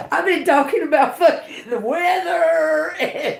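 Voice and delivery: high voice breaking into laughter; laughing disbelief. Real, not acted. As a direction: laugh through the words.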